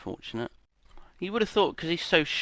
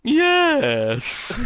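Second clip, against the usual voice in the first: ghost voice; 13 semitones higher, 6 dB louder than usual. Acted. [name] plays Gary Coleman's ghost.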